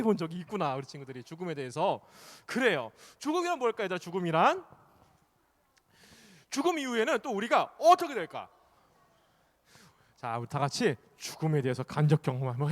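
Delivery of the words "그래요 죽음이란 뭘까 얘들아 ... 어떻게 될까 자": ordinary talk, nothing marked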